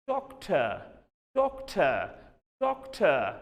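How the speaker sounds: doctor said doc-tuh, British accent with no r; mock British speaker, rounded lips. Shown as a demonstration. Teacher imitating a British speaker for students, British accent. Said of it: "British English speaker हूं, तो मैं ऐसे पढ़ूंगा".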